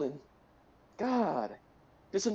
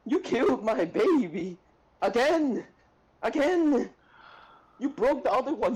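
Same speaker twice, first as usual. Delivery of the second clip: loud, higher, furious accusation; angry outburst; 5 semitones higher, 5 dB louder than usual. Real, not acted. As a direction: yell it at the one who killed her character. Ranting at the player's character.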